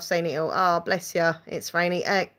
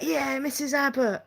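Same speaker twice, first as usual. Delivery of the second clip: put-on voice of smitten schoolboys, pitched up; mock the boys fawning over their teacher; 5 semitones higher than usual. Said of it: "the boys would be like".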